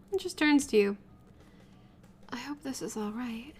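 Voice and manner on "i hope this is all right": quiet. Acted. Shy aside to partner.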